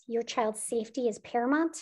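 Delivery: usual speaking voice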